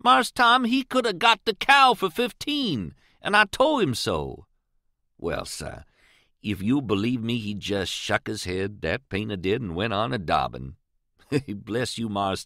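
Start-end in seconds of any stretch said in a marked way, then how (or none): 0.0-7.9 put-on higher, earnest old servant voice; Jim's voice. A narrator's reading. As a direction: tell it like a proud old family servant. Jim's voice in the story.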